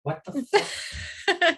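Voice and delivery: high-pitched laughing disbelief. Real, not acted. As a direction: crack up while asking, high and drawn out. Players crack up at gag.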